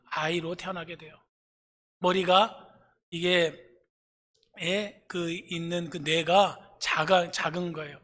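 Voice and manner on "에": normal talking voice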